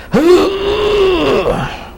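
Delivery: high thin panicked gasp; imitating a wrong gasp. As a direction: show the wrong way to breathe in. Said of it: "that's not what I told them to do".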